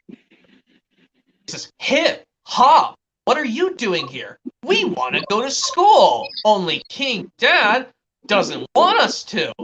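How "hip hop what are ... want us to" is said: put-on high, swinging kid-villain voice; cartoon Koopa kids. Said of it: "from the Super Mario World cartoon".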